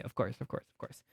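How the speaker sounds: unvoiced, whispering it to yourself; whispered aside. Real, not acted. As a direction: breathe it under your breath. Muttered aside to self.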